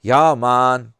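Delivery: loud drawn-out mock island accent; hammed-up Jamaican greeting, loud and long; put-on accent. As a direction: belt out a Jamaican-style "yah, mon". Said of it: "supposed to be a certain accent".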